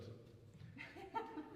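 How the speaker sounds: embarrassed laugh. Real, not acted. Embarrassed about wrong edition.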